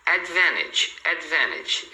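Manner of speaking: advantage as "advenedge", no T; natural dropped T. Shown as a demonstration. Teacher showing natural daily speech, advantage without T. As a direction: omit the T in advantage. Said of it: "eles omitem esse T".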